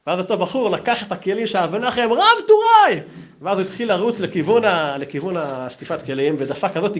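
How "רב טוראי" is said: high, loud mock announcement; hammed-up triumphant shout; acted calling out. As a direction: call the rank out across the room.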